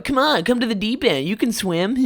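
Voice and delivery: mock the bullies luring him in; lightly voiced sing-song taunt; taunting kids.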